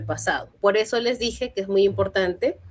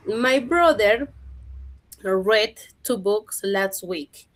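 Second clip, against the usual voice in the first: slow dictation; much slower than usual. Shown as a demonstration. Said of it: "reads example sentence slowly".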